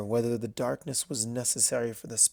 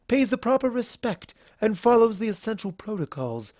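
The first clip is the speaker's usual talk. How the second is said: a slightly raised, composed lady's voice; lightly voiced Rarity; 6 semitones higher than usual. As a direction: speak with poised, earnest conviction. Rarity speaking to Princess Luna.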